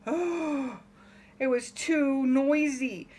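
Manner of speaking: usual speaking voice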